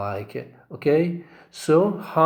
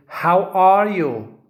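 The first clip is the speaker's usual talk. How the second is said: louder and higher, slowed, weight on are; loud demo of How are you; 6 semitones higher, 7 dB louder, slower than usual. Shown as a demonstration. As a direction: boom it out to show loud stress. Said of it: "This is louder".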